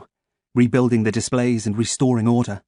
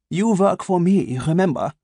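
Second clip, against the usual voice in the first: put-on German accent; 7 semitones higher than usual. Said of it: "spiced with a German accent".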